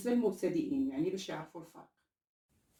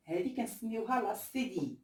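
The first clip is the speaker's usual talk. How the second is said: usual speaking voice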